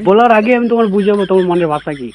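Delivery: ordinary delivery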